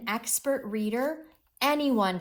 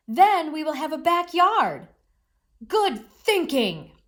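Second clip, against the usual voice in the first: excited and much louder and higher; exclaimed; 7 semitones higher, 9 dB louder than usual. A narrator's reading. Mole exclaims to Mouse about the backyard, excited. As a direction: exclaim it with joy. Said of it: "exclaimed Mole".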